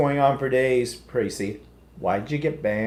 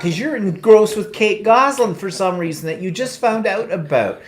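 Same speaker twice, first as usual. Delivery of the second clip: mock exasperation; 6 semitones higher, 7 dB louder than usual. Real, not acted. Scolding co-host over tangent.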